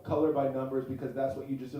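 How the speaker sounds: ordinary talk, nothing marked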